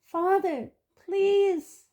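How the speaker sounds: high, pleading cry; desperate plea. Acted. Boy in chains begging his father, high desperate plea. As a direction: beg your father, voice cracking high.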